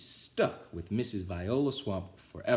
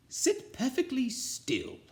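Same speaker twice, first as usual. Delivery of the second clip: witchy teacher; slower than usual. A narrator's reading. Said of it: "said Missus Swamp".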